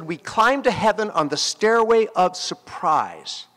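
slow, weighty recitation of the quote; quoting a poet, slow and deliberate; slow quoted line. Shown as a demonstration. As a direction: lay the poem line out slowly. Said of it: "The great poet Wallace Stevens said".

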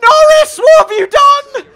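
very high, full-voiced yell at a rival; outraged shout. Real, not acted. Gamer to the driver who hit him, outraged shout. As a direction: scream it in real disbelief, stretched out. Norris crashes into the speaker.

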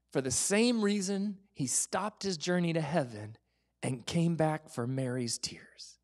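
ordinary talk

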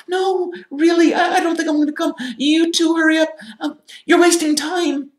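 loud, very high squeak; distressed Hermione. A narrator's reading. Hermione hiding from her friends behind a door, distressed high voice. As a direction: insist in a piercingly high voice that they hurry off. Hermione sends the boys away.

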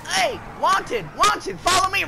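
loud, high call to a teammate; calling his teammate over. Real, not acted. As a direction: shout across the map for them to follow.

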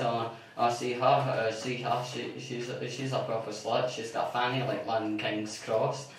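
put-on low, quiet gossiping old man; a low, hushed gossip about the neighbour; old neighbour Mister Logan. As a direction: mutter it like a sneering old neighbour. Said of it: "and he says".

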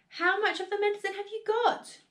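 high, excited asking; eager question. A narrator's reading. Father questioning George about the medicine, high eager voice. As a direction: ask as if much depends on it.

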